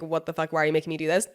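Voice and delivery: ordinary delivery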